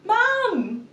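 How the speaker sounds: high, loud, stretched call; imitated toddler yell. Toddler sat up in bed as mum peeks in, high yell.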